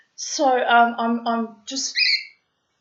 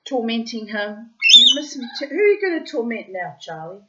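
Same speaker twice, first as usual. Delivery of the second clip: talking to a pet; 2 semitones higher, 9 dB louder than usual. Real, not acted. Talking to her pet Charlie about Bobo, cooing. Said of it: "talking to her pet".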